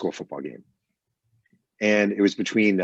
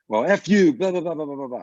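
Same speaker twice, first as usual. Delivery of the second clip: angry outburst; 8 semitones higher, 4 dB louder than usual. Retold or imitated. Ballplayer cursing out reporter.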